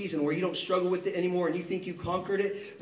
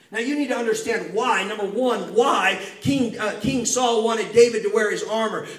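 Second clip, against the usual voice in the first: louder, driving sermon delivery; fired-up preaching; 5 semitones higher, 7 dB louder than usual. Acted. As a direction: preach it hard to the congregation. Preaching at full force.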